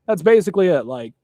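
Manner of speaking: normal talking voice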